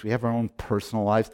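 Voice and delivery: ordinary delivery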